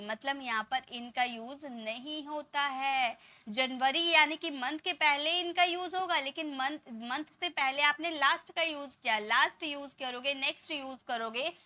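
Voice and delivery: ordinary delivery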